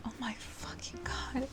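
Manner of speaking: breathless whisper of disbelief; shocked whisper. Real, not acted. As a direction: mouth it almost soundlessly, stunned. Stunned reaction to a character's betrayal.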